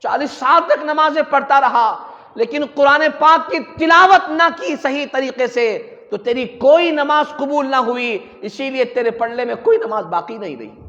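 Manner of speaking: loud, impassioned sermon; full-force preaching. Real, not acted. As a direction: hammer out the warning to the faithful.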